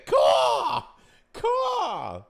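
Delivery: shouted high squawk; loud bird caw. Pretend. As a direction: screech like a crow. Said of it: "Sorry, that was a bad".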